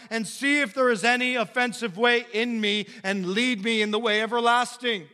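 pleading prayer, loud and high; David's prayer. Acted. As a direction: cry out the psalm as a prayer. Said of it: "We gotta pray like David".

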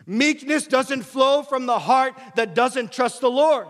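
loud, high, driving sermon voice; impassioned pulpit delivery; full-force preaching. Acted. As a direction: preach it hard to the whole room.

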